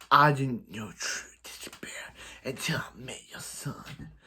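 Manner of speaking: put-on hushed, breathy villain in a would-be southern accent; half-whispered mock villain with a southern twang; Slade, southern-sounding accent. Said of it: "I know that sounds like a southern accent".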